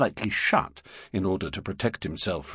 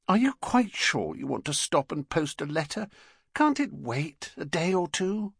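Mrs Brown; 8 semitones higher than usual. Acted.

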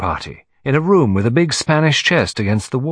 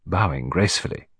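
plain reading voice

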